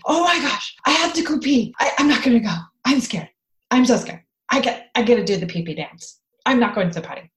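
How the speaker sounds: pretending to panic about going pee; mock potty fear. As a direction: play a kid too terrified to use the toilet. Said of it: "you would pretend like you were scared of the potty".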